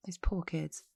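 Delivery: a soft, unvoiced aside of pity; pitying whisper. Real, not acted. Host telling listeners of the abused kids, whispered pity. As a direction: breathe it out, barely voiced.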